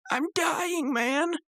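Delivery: very high strained mock agony; badly faked dying whine; Rigby. Rigby fakes agony, retold.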